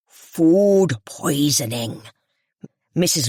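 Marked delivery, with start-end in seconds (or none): 0.1-2.0 Food poisoning, very slowly, leaning hard on poisoning; stress on poisoning. A narrator's reading. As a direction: drag out poisoning for effect. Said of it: "great emphasis on the word poisoning".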